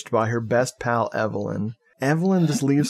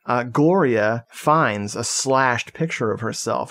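normal talking voice

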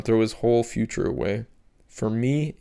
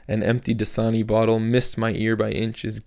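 plain reading voice

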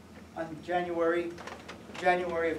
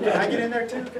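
laughing; 3 semitones higher, 8 dB louder than usual. Retold or imitated. Joking with [name].

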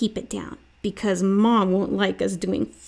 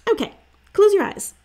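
high, loud and quick; excited bossy sister; 11 semitones higher, 6 dB louder, faster than usual. A narrator's reading. Sister eagerly starting a game, high, loud, fast. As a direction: rush it out like a sister with a plan.

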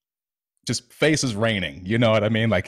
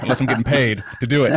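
quick and louder, laughing as he says it; laughing through it; 4 semitones higher, 6 dB louder, faster than usual. Real, not acted. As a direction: laugh off the tears with a joke. Joking to hold back tears.

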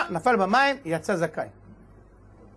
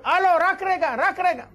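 high, loud call to halt; shouting to stop; 9 semitones higher, 3 dB louder than usual. Acted. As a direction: yell out to stop them in time. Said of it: "פתאום שומעים צעקות". (צעקה גבוהה ורמה לעצור)

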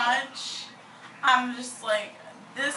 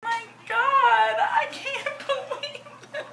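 overwhelmed, crying and laughing; tearful joyful disbelief; shocked crying; 10 semitones higher than usual. Real, not acted. Reacting to Disney trip surprise.

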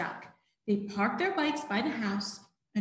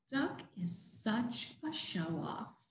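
quiet thought; 12 dB quieter, slower than usual. Acted. Pigs' secret thought, soft.

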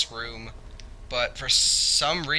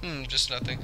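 normal talking voice

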